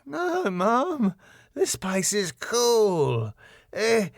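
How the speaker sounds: high, drawn-out tipsy voice; drunk. Acted. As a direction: slur happily like a drunk teenager. Drunk Simon answers his mother.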